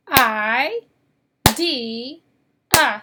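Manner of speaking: "I, D, A" as three separated syllables. Shown as a demonstration. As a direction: break idea apart, "I", "D", "A", with clear pauses. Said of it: "how many syllables in the word idea".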